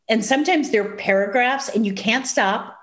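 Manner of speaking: normal talking voice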